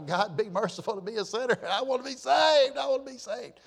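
high-pitched imitated cry for salvation; passionate plea. Preacher retells a man running down the aisle, pleading passionately. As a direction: plead urgently, rising high. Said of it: "That passion, that passion".

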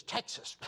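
unvoiced-sounding, spat-out imitation of a scornful guest; spitting disgust. Unhappy guest complaining about Texas, spitting in disgust. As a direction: spit the word out in disgust. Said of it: "I know I spit".

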